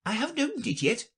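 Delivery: very high, quick reply; high-pitched courtier rabbit. Acted. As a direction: answer fast as a little rabbit.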